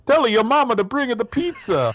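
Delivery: mock Italian accent, high and loud. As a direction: ham up a broad Italian accent. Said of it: "made fun of his bad Italian accent".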